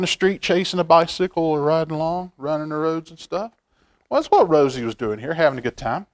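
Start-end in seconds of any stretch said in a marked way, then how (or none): none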